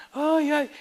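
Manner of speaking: high wailing cry of a hammed-up king; mock lament. Preacher mimicking Ezekiel wailing, high. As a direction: wail like a sick man mocked.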